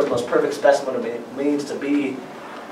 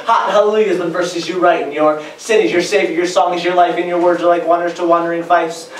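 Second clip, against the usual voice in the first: pitched-up, driving slam poetry delivery; spoken-word performance; 5 semitones higher, 4 dB louder than usual. Acted. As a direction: perform it to a crowd at the mic.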